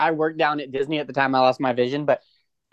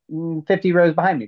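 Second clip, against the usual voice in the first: normal talking voice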